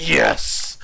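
hard hissed victory cry; triumphant. Retold or imitated. Host retelling his prize win as a kid, triumphant mock cheer. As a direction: celebrate a surprise win. Retold winning reaction.

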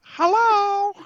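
high, loud cartoon hello; tiny fire character. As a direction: pipe up as a playful tiny fire.

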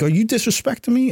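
instructor's challenge. Retold or imitated. Instructor confronting recruit mid-march.

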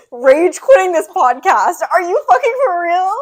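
loud, high, laughing outrage; mock outrage. Real, not acted. Cohost fooled by a fake story, loud laughing outrage. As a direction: yell in laughing disbelief.

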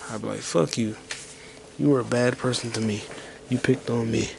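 normal talking voice

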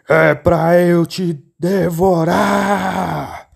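slow, menacing wolf snarl; wolf springing the trap; mock wolf shout. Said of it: "o lobo gritou". (lobo ameaçador, bem lento)

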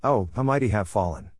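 usual speaking voice

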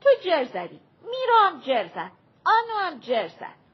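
put-on high, loud childish accusing; a sulky kid complaining everyone cheated; whining complaint. A narrator's reading. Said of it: "شروع کرد به گله و شکایت".

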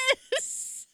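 a breathless unvoiced cry of triumph; excited yes. Reacting to a text from Christina, excited yes. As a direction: cheer it like great news arrived. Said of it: "And I'm like".

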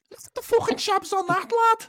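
high-pitched Scouse demo; Liverpool accent. Shown as a demonstration. Host revealing the actual answer, high Liverpool accent. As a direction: pitch it right up in broad Scouse. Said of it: "the actual answer was".

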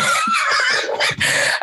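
very high squealed laugh; high-pitched laugh. Real, not acted. Admitting she cries about the gift, high laugh.

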